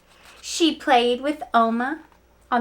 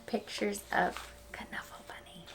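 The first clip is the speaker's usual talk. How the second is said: whisper; 13 dB quieter than usual. Real, not acted. Teacher whispering aside.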